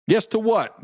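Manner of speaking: high, baffled question; puzzled. Real, not acted. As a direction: ask it as if the answer makes no sense. Puzzled reply to chat answer.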